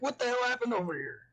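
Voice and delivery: what the hell, startled; shocked outburst. Acted. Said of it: "Rose will be like".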